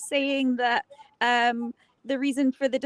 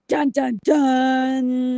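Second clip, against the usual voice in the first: loud mock suspense sting, last note drawn out; hammed-up dramatic reveal stinger; dramatic "dun dun dun"; 6 dB louder, much slower than usual. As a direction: sing out a soap-opera cliffhanger sting. Mock suspense sting after revelation.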